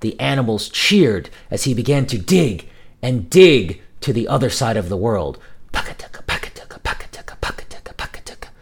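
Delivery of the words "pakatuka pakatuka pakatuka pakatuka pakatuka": rhythmic unvoiced digging sounds; whispered digging chant.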